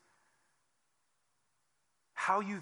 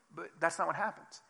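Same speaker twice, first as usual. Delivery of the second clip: ordinary delivery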